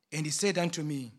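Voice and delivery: ordinary talk, nothing marked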